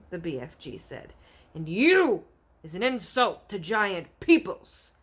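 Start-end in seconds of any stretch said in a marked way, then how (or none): 1.5-5.0 loud scornful giant shout. Acted. Bloodbottler shouting scorn at the BFG. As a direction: hurl the insult. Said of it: "shouted the blood bottler".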